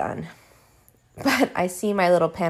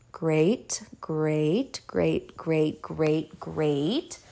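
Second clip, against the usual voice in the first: sarcastic whispered great; 3 semitones lower, 5 dB quieter, much slower than usual. Retold or imitated. She dreads the numbness news.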